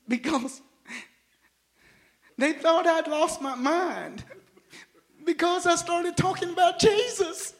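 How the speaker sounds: loud, high preaching cry; preaching at full force. Acted. Preacher telling the church his story, preaching loud. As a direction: shout the testimony to the room.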